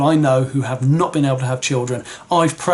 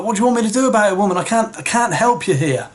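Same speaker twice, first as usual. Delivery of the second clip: a higher, exasperated mock outburst; frustrated outburst; 7 semitones higher, faster than usual. Jacob snapping back at his wife, mock frustrated. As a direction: throw up your hands at her, lightly acted. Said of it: "He feels frustrated and he's like".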